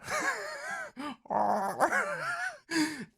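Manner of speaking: a high giggle that breaks up; high squealing laugh. Real, not acted. Streamer cracks up reading the script, high giggle.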